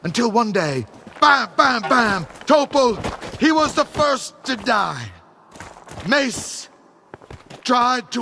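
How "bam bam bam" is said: punchy imitated gunshots, bam bam; loud mock gunfire sounds. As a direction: bark out the shots like gunfire. Retelling gunfire attack.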